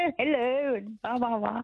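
posh phone voice. Retold or imitated. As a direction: imitate a mother's best phone manners. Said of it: "She'd say".

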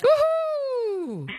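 high whooping cheer. Real, not acted. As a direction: celebrate with a big high whoop. Host cheers toilet success.

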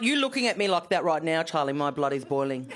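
normal talking voice

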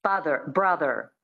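American Father, brother with final r sounded; standard American. Shown as a demonstration. Coach listing example words, standard American accent. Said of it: "So that's the American".

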